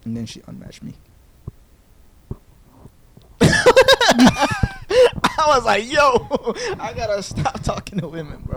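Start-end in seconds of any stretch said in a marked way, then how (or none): 3.4-4.1 a sudden shrieking laugh; high burst of laughter. Real, not acted. After the girl unmatches him, shrieking laugh. 4.2-8.6 words squeezed out through laughter, high; laughing. Real, not acted. Telling his co-host he should stop talking to women, laughing.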